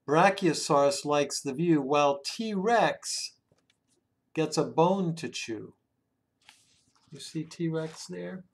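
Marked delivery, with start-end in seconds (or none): none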